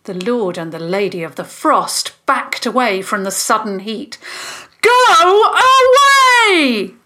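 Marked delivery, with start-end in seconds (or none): 4.8-6.9 long, very high, full-force scream; drawn-out banishing yell. A narrator's reading. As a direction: yell it out, stretching both words. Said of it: "the Fentons yelled".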